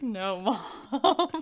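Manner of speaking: loud, laughing protest. Retold or imitated. Daughter setting mom straight, loud and laughing. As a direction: break up laughing while correcting mom. Retelling amused answer to mom.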